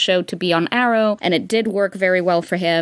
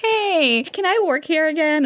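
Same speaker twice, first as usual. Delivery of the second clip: sheepish sweet voice; 8 semitones higher than usual. Retold or imitated. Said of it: "I was like".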